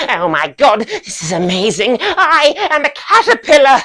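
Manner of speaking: building to a high, loud squeal; overexcited student. Acted. Student becoming a caterpillar, ecstatic. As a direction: gush with hammed-up wonder.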